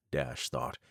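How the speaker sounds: ordinary delivery, nothing marked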